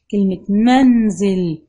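منزل slow and loud, stopping on the silent ن; منزل spoken slowly, loudly, ن held without a vowel; stressed sukun demo. Shown as a demonstration. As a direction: hold the silent ن of منزل firmly. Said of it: "وقفت وسكنت الإيه؟".

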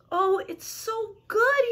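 high, slow savouring; drawn-out storybook bliss; delighted. A narrator's reading. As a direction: swoon over a delicious taste. Larch savours the juice.

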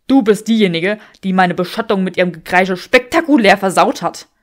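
loud man's voice, du rising; man mirroring her accusation; angry Draco. Acted. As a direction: hurl the blame right back.

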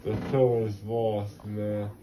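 ordinary delivery

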